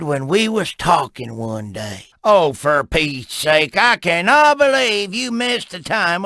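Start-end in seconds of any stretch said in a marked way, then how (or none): none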